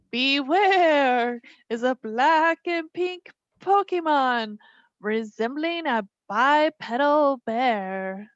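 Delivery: slow, drawn-out ghostly reading; spooky voice. Pretend. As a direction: read it like a ghost story. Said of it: "Should I read it in a spooky voice?".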